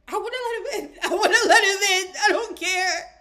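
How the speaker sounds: high, laughing protest, repeated emphatically; laughing insistence. Real, not acted. Reviewer telling listeners she'd refuse him entry, laughing. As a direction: insist through real laughter. Laughing over her own verdict.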